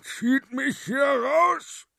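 high, loud, slow groaning shout; desperate strained yell from under the shelves; strained cry for help. Acted. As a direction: call out while stuck and struggling. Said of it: "ächzte die Person".

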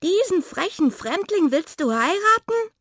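high, loud, outraged question; shocked disbelief. Acted. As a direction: demand it, scandalised. Friends are stunned at her choice. (hoch und laut, entsetzt)

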